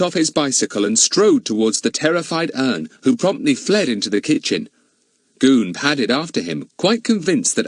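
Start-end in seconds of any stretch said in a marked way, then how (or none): none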